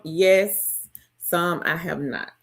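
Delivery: ordinary talk, nothing marked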